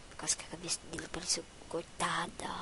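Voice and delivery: fast, breathy whispered aside. Real, not acted. Narrator mocking himself for the recording, fast whisper. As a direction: whisper it quickly as a secret.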